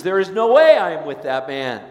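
shouted flat refusal; loud denial. Acted. Peter denying Jesus to the people by the fire, loud denial. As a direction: bark it to shut them up.

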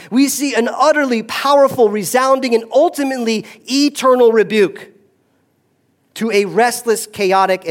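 high, loud, ringing proclamation; full-force preaching. Acted. Pastor to congregation, proclaiming God's rebuke loudly.

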